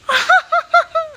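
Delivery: sudden high laugh; high burst of laughter. Real, not acted. Laughing at her own pregnancy joke, high and bright.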